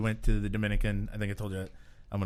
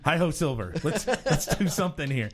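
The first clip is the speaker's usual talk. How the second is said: mock giddy-up; 5 semitones higher than usual. Urging a stuck horse to move.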